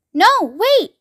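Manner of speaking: put-on high urgent cry of a boy. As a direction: call out to stop them, high. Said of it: "cried Charlie".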